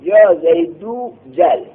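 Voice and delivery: یا زیدو جل spelled out slowly, word by word; slow recitation of یا زیدو جل for the class; slow model phrase. Shown as a demonstration. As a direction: lay out each word of یا زیدو جل deliberately. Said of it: "فتقول".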